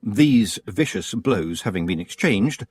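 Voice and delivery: ordinary delivery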